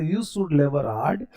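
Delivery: slow, clear English model sentence. Shown as a demonstration. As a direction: spell it out slowly for the class. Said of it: "एक word सीखते चलेंगे". (धीमा, साफ़ उच्चारण)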